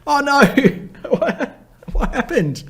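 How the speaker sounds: high startled "oh no", half laughing; high-pitched amused shock; surprised. Real, not acted. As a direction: caught off guard and amused. Sudden news surprises the host.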